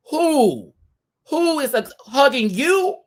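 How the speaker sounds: high, loud offended squeal; mock outraged who. Reacting to the hug offer with a high outraged squeal. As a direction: shriek at the very idea of a hug.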